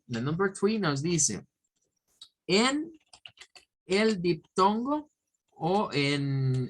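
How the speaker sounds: normal talking voice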